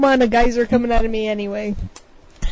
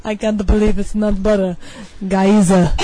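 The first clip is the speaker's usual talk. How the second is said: ad announcer voice; 5 semitones lower than usual. Pretend. Ad voiceover parody.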